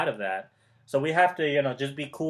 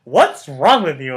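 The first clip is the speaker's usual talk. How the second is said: louder, slow baffled asking; mock-puzzled relative blurting it out; puzzled family member; 8 dB louder than usual. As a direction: act out a relative who can't believe the change. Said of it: "your family members are gonna be like".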